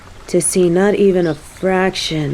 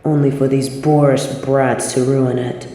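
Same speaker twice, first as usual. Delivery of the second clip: calm them gently to sleep; hushed tender soothing; soothing lull; 4 semitones lower than usual. Acted.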